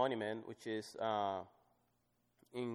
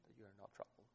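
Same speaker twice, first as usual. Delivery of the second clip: nearly whispered, much softer; hushed; 3 semitones lower, 22 dB quieter, faster than usual. Shown as a demonstration. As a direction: drop to a whisper of comfort. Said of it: "But I want you to look at this".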